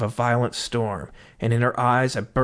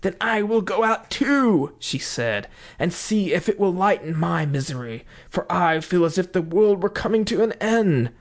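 desperate wife; 5 semitones higher than usual. Acted. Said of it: "hair standing out like flames".

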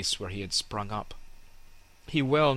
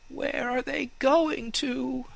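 high, slow anxious fir tree voice; a young tree asking anxiously; worried young fir tree; curious fir tree; slower than usual. Acted. Fir asks about the cut trees.

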